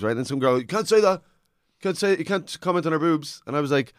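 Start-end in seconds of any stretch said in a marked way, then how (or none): 0.6-3.4 high-pitched imitation of a heckler's protest; mock shouting. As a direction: mimic an objecting heckler, pitched well up. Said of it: "she just kept shouting".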